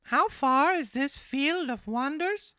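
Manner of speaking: high puppet voice, drawn out and loud; marionette voice. Acted. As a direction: gush to the cat in a high marionette voice. Pinocchio questions the fox.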